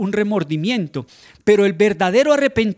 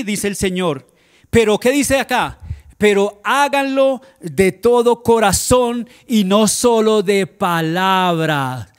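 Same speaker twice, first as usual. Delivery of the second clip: louder, driving sermon delivery; fervent preaching; 2 semitones higher, 5 dB louder, slower than usual. Acted. Preacher urging the church to repent, louder fervent preaching. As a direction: preach it hard to the congregation.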